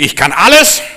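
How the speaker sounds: loud boast rising at the end; mock cocky declaration; proud, arrogant. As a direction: brag with full haughty confidence. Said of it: "zu stolz und zu hochmütig".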